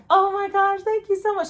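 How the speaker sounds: high, loud grateful squeal; gushing thanks. Retold or imitated. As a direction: thank her like you're about to burst. Gushing thanks, near tears.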